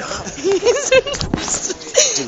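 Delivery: high, loud laughing fit; burst of laughter. Real, not acted. Announcer breaks up during the captions, high loud laughter. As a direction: crack up loudly, pitch shooting up.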